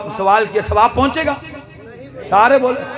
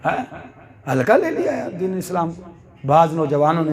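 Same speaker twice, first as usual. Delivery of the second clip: usual speaking voice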